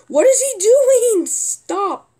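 exasperated shout. Real, not acted. Exasperated at teammate griefing.

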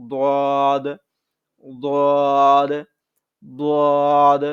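দদ said heavy, thick and loud; full, heavy দদ; thick dad. Shown as a demonstration. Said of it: "এটা হচ্ছে মোটা করে পড়বো". (মোটা করে দদ)

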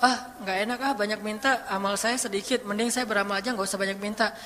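mock timid self-doubt; timid excuse. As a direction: sound shy about asking too much. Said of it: "Jangan suuzon sama Allah terus bilang".

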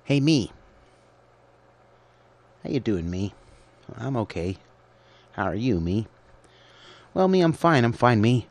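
a light put-on voice greeting himself; talking to himself. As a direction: chat with yourself like a goofy old pal. Talking to himself as two people.

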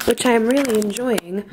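ordinary delivery

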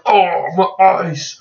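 slow, drawn-out mock cry of pain; mock agony. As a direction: play being blinded, dragging the words. Mimicking pain at bright screen.

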